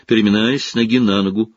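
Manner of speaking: usual speaking voice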